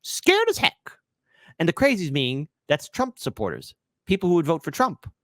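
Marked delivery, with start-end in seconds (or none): 0.0-0.9 squeaky mock echo, much higher; high mocking squeak. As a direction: parrot her in a frightened squeak. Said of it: "Kamala says".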